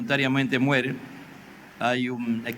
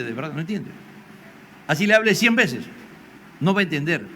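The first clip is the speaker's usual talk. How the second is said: ordinary talk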